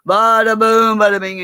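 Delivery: high, loud, boisterous Italian chef; hammed-up drunken cooking-show patter. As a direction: play a tipsy chef showing off. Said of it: "the Italian and the".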